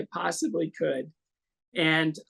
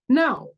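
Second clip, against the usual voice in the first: higher, louder, decisive no; firm no; 7 semitones higher, 6 dB louder than usual. Retold or imitated. Answering the reporter's question, a firm loud no. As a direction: say it plainly and emphatically.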